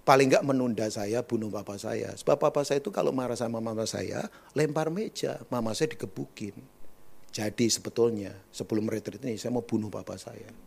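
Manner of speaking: low, unemotional testimony in a level tone; flat, emotionless. Retold or imitated. Young man testifying about his violent father, flat. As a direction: recount it with no feeling whatsoever. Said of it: "kalau ngomong datar begini bahaya".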